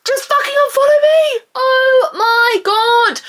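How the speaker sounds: high, strained outburst, drawn out at the end; exasperated screech. Real, not acted. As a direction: screech it in frustration. Exasperated rant at muting followers.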